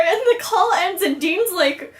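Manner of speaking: ordinary talk, nothing marked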